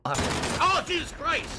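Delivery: frightened high cry; scared. Real, not acted. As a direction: jump out of your skin. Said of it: "scared the shit out of me".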